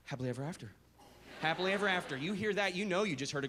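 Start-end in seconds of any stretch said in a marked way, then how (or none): 0.0-0.7 lower, softer tender close; soft storybook ending. Shown as a demonstration. Parent closing a bedtime story, soft and tender. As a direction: sigh it like a lullaby. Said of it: "How do they all end?".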